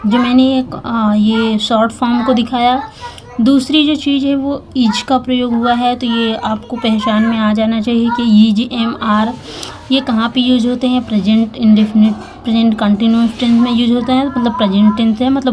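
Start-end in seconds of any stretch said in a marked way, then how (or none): none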